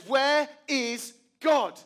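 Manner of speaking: slow, loud, climbing menace; the third time, give it full weight; pastor's final demand. Retold or imitated. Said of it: "the third time".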